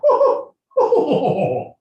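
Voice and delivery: high-pitched laughing "oh"s; mock exclamation. Acted mock reaction in rant.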